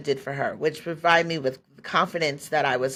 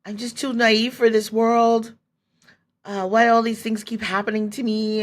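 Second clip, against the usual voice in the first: retold woe-is-me whine, pitched higher; lightly acted despair, higher than usual; self-pitying lament; 5 semitones higher, 3 dB louder than usual. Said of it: "I was feeling like".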